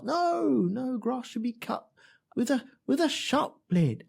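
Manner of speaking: high-pitched mock accent, nagging; Jackie Stewart impression. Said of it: "I don't know what accent I'm doing there".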